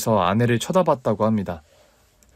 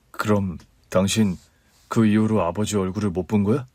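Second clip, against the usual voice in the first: husband asking; 4 semitones lower than usual. Acted. Husband asks wife.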